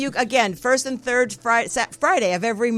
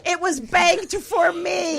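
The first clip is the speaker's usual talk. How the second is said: high, drawn-out playful protest; mock-outraged whine; 9 semitones higher, 3 dB louder, slower than usual. Host protesting her prepaid swearing was stolen, mock outrage. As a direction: wail it in pretend indignation.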